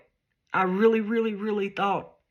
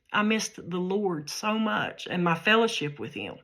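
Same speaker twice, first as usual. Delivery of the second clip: usual speaking voice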